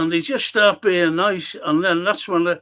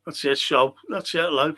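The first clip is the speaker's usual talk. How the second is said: ordinary talk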